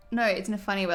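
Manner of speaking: usual speaking voice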